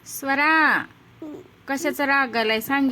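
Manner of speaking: mother begging her daughter to explain her anger, loud pleading; pleading call. Real, not acted. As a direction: raise the voice and beg her to tell.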